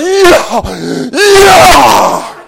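loud acted victory yell, climbing high; triumphant whooping. Rider who survived the drop, whooping in triumph. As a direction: whoop it out, second one bigger. Reenacts celebrating at the bottom.

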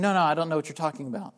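quick, low, offhand mock denial; imitating a momentary, dismissive denial; casual brush-off. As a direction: toss off a passing denial in a hurry. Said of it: "was not just saying".

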